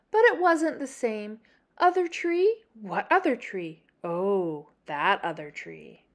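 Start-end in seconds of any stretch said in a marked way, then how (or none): none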